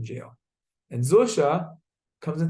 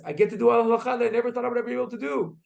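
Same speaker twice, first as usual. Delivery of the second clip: high, fast burst of retold glee; excited; 9 semitones higher, faster than usual. Zusha rejoices in the jail cell, acted excitement. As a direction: gush it in a storyteller's high, thrilled voice. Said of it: "Zusha gets all excited".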